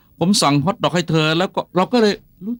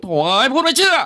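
exasperated outburst; 7 semitones higher than usual. Acted. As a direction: throw up your hands at her disbelief. Husband exasperated at disbelief.

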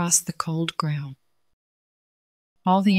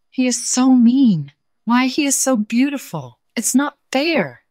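indignant complaint; 7 semitones higher, 4 dB louder than usual. Acted. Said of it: "said the animal".

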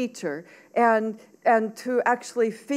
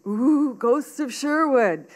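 drawn-out, higher mock-spooky call; spooky teasing boy; 4 semitones higher, 3 dB louder, slower than usual. A narrator's reading. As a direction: tease with a slow ghostly ooh.

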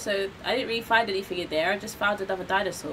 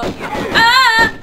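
high, loud sudden scream as the dinosaur bites; startled scream; 13 semitones higher, 13 dB louder than usual. Real, not acted. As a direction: yelp in real shock. Chomped by a dinosaur.

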